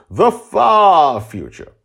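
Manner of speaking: slow, then loud grand repeat; host teasing the future, slow and grand; dramatic echo. Shown as a demonstration. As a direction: boom it out like a trailer. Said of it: "dramatic echo".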